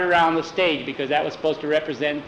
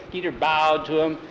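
ordinary delivery, nothing marked

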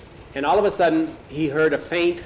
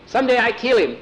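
much higher, louder outburst; heated vengeful vow; 9 semitones higher, 5 dB louder, faster than usual. A narrator's reading. Captive boy swearing revenge on Jose, heated vengeful vow. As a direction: drive the vow home, pitch leaping up.

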